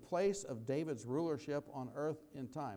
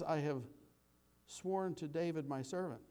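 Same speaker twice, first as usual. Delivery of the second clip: slow, measured reading of the psalm; scripture reading; 5 semitones higher than usual. Acted. Pastor reading the psalm to his congregation, slow and measured. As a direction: read it out like scripture, unhurried. Rereads the verse.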